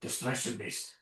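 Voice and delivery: soft, secretive murmur; furtive aside. Retold or imitated. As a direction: sidle up and confide it quietly. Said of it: "sidles up and goes".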